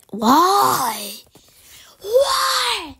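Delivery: loud, high, drawn-out wail; frustrated cry. Real, not acted. Gamer protesting to the game, frustrated cry.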